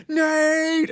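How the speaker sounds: high, joyous shout of a name; crowd cheering. Acted. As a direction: cheer like a whole bar at once. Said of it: "looks at Nate and goes".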